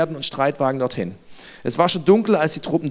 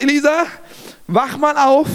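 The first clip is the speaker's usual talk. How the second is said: higher-pitched, mildly alarmed call; 5 semitones higher than usual. Acted. Servant waking his master Elisa, mildly alarmed call. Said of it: "rief er".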